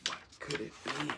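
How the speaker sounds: quiet wondering storyteller; hushed. A narrator's reading. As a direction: keep it soft but voiced. Narrator repeats the question.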